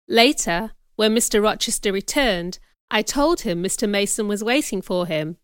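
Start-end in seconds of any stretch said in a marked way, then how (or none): none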